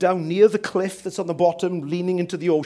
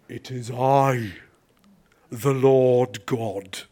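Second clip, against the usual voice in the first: slow, low, stately divine voice; voice from the heavens; 5 semitones lower, much slower than usual. Acted. God answers man on the branch, slow deep heavenly voice. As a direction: intone it slowly as God. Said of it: "a voice from the heavens".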